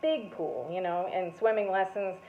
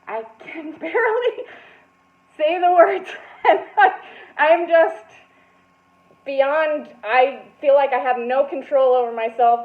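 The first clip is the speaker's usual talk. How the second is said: higher, louder, words broken by laughter; laughing through words; 4 semitones higher, 7 dB louder than usual. Retold or imitated. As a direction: barely get the words out between laughs. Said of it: "I am laughing through my poem".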